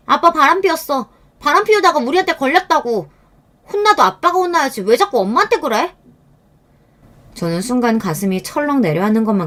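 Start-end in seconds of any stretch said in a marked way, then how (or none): 0.1-5.9 angry daughter. A narrator's reading. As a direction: spit out the secret. Daughter exposing father's affair.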